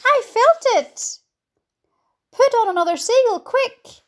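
high, urgent cries; excited shouting. A narrator's reading. Crew urging another catch as peach lifts, excited shouting.

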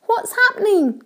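high-pitched startled question; alarmed cry. A narrator's reading. James leaping from his hammock, alarmed cry. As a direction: cry out high, wanting to know. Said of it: "cried James".

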